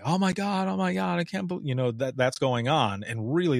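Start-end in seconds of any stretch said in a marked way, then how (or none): 0.0-1.7 mock yelling. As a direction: mimic a frantic caller without raising volume. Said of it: "they're just yelling and yelling".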